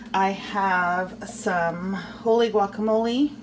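ordinary talk, nothing marked

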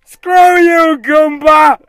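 loud high angry yell. Real, not acted. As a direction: yell at the enemy. Yelling at game enemy.

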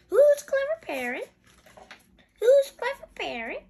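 high, loud parrot voice; Polly. Acted. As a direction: screech it like a parrot, rising at the end. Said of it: "she says".

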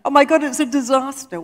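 high, loud mock wail of panic; imitating a shrieking passenger; mock scream. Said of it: "she screamed so loudly".

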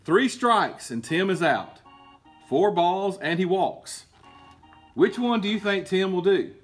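usual speaking voice

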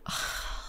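soft breathy sigh; low-energy exhale; weary sigh. Real, not acted. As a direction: let out a quiet, tired breath. Weary pause before wishing for vodka.

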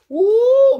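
high, loud surprised ooh. Real, not acted. Reading to kids, reacting to the fall, surprised. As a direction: gasp it out big.

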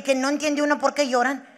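ordinary talk, nothing marked

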